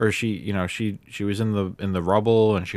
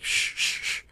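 whispered shushing; imitating grandma's hushed shh; hushing shh; 3 dB quieter, slower than usual. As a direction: shush someone urgently under your breath. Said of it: "she hushes me".

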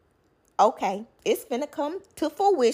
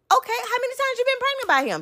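fast, much higher disbelieving question; incredulous high; much faster than usual. Retold or imitated. Girlfriend grilling the wife, fast and incredulous. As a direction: rattle it off high and shocked. Speaker's own retold question.

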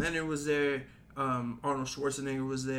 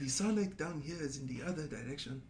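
softer put-on Wakandan accent; African-accented delivery, played straight and softer; T'Challa; 7 dB quieter than usual. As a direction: imitate a Wakandan king giving directions. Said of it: "trying to copy the lines when T'Challa's talking".

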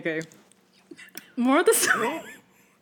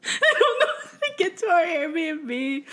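laughing; 7 semitones higher, slower than usual. Retold or imitated. Laughing quote to dad.

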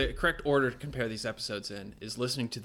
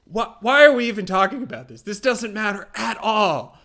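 loud, high, incredulous protest; hammed-up outburst of dismissal; mock exasperation; 9 semitones higher, 11 dB louder than usual. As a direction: act out fed-up disbelief, drawing out the end. Acts out Brits dismissing the topic.

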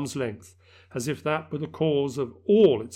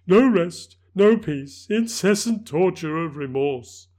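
louder, anguished spectral voice; a tormented spirit lamenting; mournful ghost; 5 semitones higher, 7 dB louder, slower than usual. Acted.

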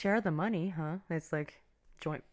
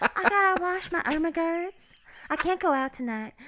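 put-on higher, sing-song Southern belle accent; a playful Southern belle accent; 11 semitones higher than usual. Woman turning down a night out, Southern belle accent. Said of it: "what's this accent I got".

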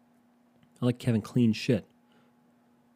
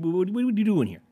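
high, exasperated outburst; 9 semitones higher than usual. Retold or imitated. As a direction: throw up your hands in disbelief. Exasperated rant at salesman.

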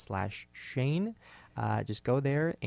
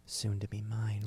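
whispered aside; 3 semitones lower, 5 dB quieter, slower than usual. Real, not acted. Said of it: "greedy aside".

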